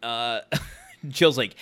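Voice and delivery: ordinary talk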